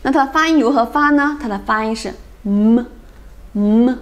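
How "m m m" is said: "m" as an isolated closed-lip hum; nasal sound demo. Shown as a demonstration. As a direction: hum "m" through the nose, not the letter name. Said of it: "它是个鼻音啊". (闭唇用鼻音示范“m”)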